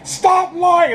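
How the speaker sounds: high, loud imitated shout at a liar; imitating yelling god. Reviewer acting out Khonshu yelling at Harrow, high mock shout. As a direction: yell it like an angry god in court. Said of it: "The way he kept yelling".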